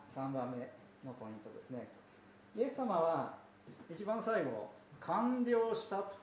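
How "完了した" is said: high ringing cry of completion; cried out. Retold or imitated. Jesus crying out on the cross, a ringing high call. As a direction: lift it up and call it out. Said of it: "叫ばれて".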